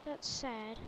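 low, soft remark; subdued reaction. Real, not acted. Player reacting to the game's Australian words, low subdued remark. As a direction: say it low, half to yourself.

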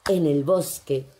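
ordinary delivery

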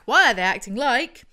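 loud question rising at the end, inviting answers; prompting question. Real, not acted. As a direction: leave it hanging for the audience to finish. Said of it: "Audience, fill in the blank".

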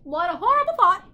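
loud, high bird squawk of horror; shuddering bird. A narrator's reading. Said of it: "the bird shuddered".

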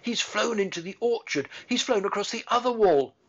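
put-on excited girl calling out; cried out. A narrator's reading. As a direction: shout after the flying robin. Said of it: "Mary cried out".

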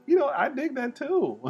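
answering the painting, delighted; excited high reply. Pretend. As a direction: gush back enthusiastically. His reply, high and cheerful.

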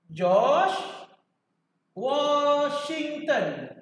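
very slow, stretching out Washington; slow teaching drawl. Shown as a demonstration. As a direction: stretch each syllable so students memorise it. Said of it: "guru ajar hafal nama". (sebutan perlahan, memanjangkan Washington)

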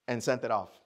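ordinary delivery

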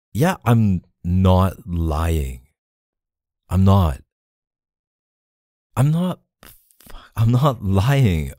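slow, emphatic protest; insistent denial. Acted. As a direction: drag out each denial to make it stick. Insisting to accusing partner on honesty.

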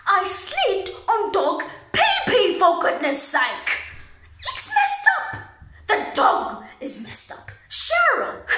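loud, indignant rant with high jumps; exasperated outrage. Acted. Outraged rant about dog and friend.

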